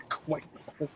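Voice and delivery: breathy whispered echo of the words; a teasing whispered echo. Pretend. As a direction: repeat it under your breath.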